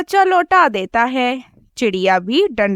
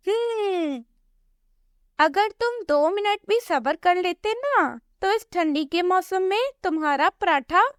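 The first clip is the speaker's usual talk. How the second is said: higher, soft lecturing parrot, quickening at the end; tut-tut like a strict doctor; scolding parrot doctor; 7 semitones higher, 5 dB quieter than usual. Acted. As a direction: parrot doctor teasing about a big injection. Parrot doctor scolds, threatens injection.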